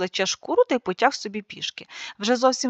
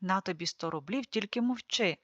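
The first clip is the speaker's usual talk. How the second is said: hushed; 3 semitones lower, 10 dB quieter than usual. Acted. Lord bribes fool to hush.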